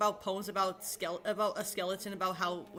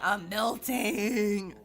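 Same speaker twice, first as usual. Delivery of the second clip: drawn-out, hammed-up I'm melting; exaggerated melting; 5 dB louder, much slower than usual. Shown as a demonstration. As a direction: ham it up like you're wilting in the heat. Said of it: "I'll just exaggerate and say".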